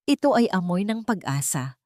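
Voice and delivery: usual speaking voice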